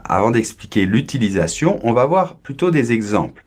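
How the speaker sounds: ordinary talk, nothing marked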